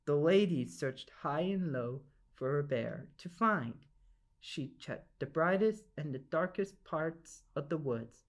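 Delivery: ordinary delivery, nothing marked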